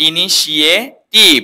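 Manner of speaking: "ইনিশিয়েটিভ" slowly broken up, i-ni-shi-e ... tiv; correct pronunciation. Shown as a demonstration. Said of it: "এটা correct হচ্ছে". (শুদ্ধ উচ্চারণে ভেঙে "ইনিশিয়েটিভ")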